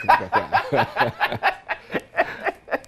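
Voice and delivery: long, high giggling laughter; real sustained laughing fit; hearty laugh. Real, not acted. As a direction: laugh on helplessly. Long laugh shared in interview.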